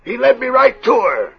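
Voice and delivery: high, loud choked-up voice; emotional. Acted. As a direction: near tears with relief. Jeb emotional when reunited with Liz.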